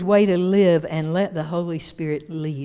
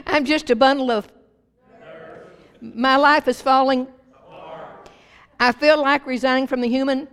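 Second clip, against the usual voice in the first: higher, louder fed-up lines left hanging; playfully overwrought, raised voice; mock exasperation; 6 semitones higher, 4 dB louder than usual. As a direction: call it out like someone at wits' end, leaving the ending open. Voicing fed-up people, audience finishes.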